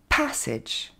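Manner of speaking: PASSAGE as "PASS-edge"; English teaching model of PASSAGE; correct English pronunciation. Shown as a demonstration. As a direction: stress PASS, short falling "edge" in PASSAGE. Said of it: "va se dire".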